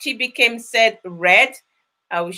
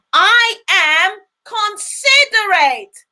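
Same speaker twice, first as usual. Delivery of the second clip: with conviction; 6 semitones higher, 3 dB louder, slower than usual. Shown as a demonstration. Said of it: "make sure you shout it with so much conviction".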